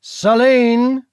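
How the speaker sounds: a loud, sharp call of her name; loud warning. Acted. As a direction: warn her to stop. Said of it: "warned in a loud voice".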